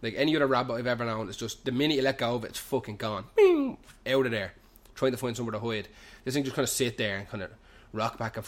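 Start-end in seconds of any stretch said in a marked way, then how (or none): 3.4-3.8 high, loud cartoon "bing"; ping it out high and sudden; imitated noise of something bolting; zipping-away sound. Imitates rabbit shooting off.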